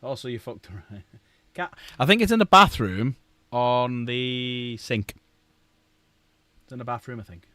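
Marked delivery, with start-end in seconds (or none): 1.6-5.2 loud, slow call through the house, laughing at the start. Real, not acted. Calling to Cat in another room about where it is, loud and slow. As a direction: shout the answer to the next room.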